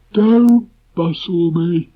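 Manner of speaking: high, slow gloomy donkey; Eeyore voice. Acted. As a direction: drag it out like a glum donkey.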